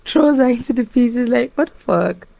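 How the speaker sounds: shocked and excited. Real, not acted. Retelling shocking twist.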